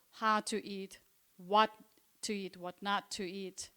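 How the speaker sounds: ordinary delivery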